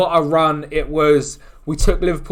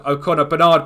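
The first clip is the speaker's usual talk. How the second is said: usual speaking voice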